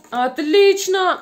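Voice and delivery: slow, loud, lifted praise; drawn-out praise. Real, not acted. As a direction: stretch the praise out. Praising the children.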